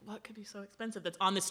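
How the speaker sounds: curious unvoiced whisper; hushed wondering aside. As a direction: whisper the question conspiratorially. Wondering about expensive item.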